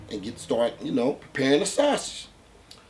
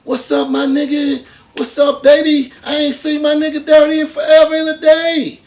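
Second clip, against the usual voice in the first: high, loud, laughing hype-man greeting; loud fake-friendly greeting; 12 semitones higher, 10 dB louder than usual. To the armed man, overjoyed fake-friend shout. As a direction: greet him like a long-lost buddy, way up high. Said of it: "So the whole time I'm jeffing".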